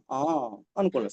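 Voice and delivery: usual speaking voice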